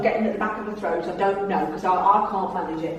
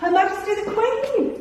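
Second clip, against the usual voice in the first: high, excited exclamation with a laugh; mock-awed gasp at the Queen; delighted; 11 semitones higher than usual. Real, not acted. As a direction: exclaim it like big news. Delighted reply to child.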